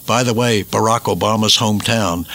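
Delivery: ordinary talk, nothing marked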